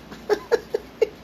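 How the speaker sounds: short burst of laughter; real, helpless little laugh; amused laugh. Real, not acted. Host laughing as her cat goes crazy on video, amused laugh.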